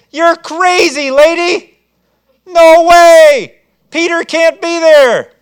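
high, loud, slow scoffing; scornful dismissal. Acted. Praying crowd loudly scoffing at Rhoda's news. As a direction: brush her off as crazy.

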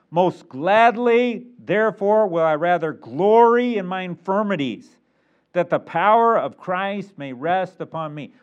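ringing pulpit recitation; emphatic scripture reading. Acted. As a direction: declaim the verse, stretching the key words.

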